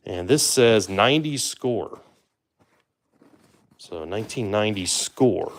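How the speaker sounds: ordinary talk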